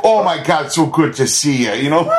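laughing mock Midwestern greeting; gushing Minnesota accent with a laugh. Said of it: "drops back into the accent".

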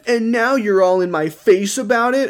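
high, exasperated mock rant; retold yelling. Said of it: "yelling at her in front of everyone".

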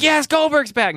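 high, thrilled cheer; excited fan cheer. Retold or imitated.

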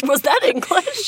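high and slow, dissolving into laughter; barely getting words out for laughing; giggling helplessly. Real, not acted. Both cracking up.

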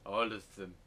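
stiff, flat movie-line delivery; wooden acting. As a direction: deliver it like a bad actor. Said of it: "that's wooden acting".

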